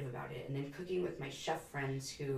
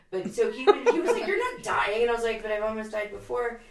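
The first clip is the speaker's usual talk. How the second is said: laughing, louder and higher; 8 semitones higher, 11 dB louder than usual. Real, not acted. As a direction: retell it through laughter. Laughing retelling of husband exchange.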